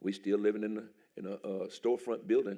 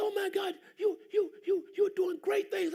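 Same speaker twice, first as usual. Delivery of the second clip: gushing director; slower than usual. Retold or imitated. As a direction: exclaim it like a fan. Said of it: "He said".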